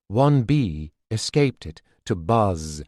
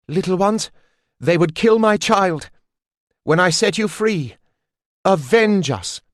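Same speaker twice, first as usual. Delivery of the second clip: fierce cry; 8 semitones higher, 4 dB louder than usual. A narrator's reading. Mother calls bees to vengeance.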